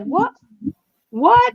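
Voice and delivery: high-pitched, questioning mock surprise; incredulous high squeak of a question; mock disbelief. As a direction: imitate someone turning round baffled, voice jumping up. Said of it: "his little face around going like".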